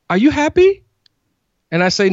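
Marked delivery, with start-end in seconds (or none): 0.1-0.8 put-on high, louder little-girl voice; daughter's voice. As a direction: ask like an eager toddler. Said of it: "then immediately asks me".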